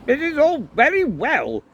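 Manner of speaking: put-on high, loud Welsh-accented priest; irritable high-pitched objection; snapping. A narrator's reading. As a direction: snap back indignantly, loud and high. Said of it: "snapped a priest".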